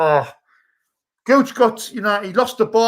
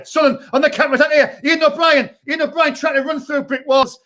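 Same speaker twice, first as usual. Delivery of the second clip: eager play-by-play; 6 semitones higher than usual. Acted. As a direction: push the call as he runs. Play-by-play of counterattack.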